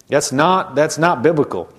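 normal talking voice

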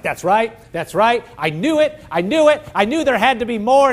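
bursting with eager delight; excited. Acted. A believer's spirit hearing God's word, excited. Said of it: "and it's excited".

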